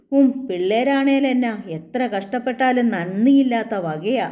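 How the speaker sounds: plain reading voice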